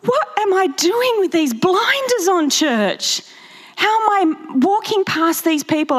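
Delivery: retold tearful self-reproach; anguished self-questioning. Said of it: "I'd be like".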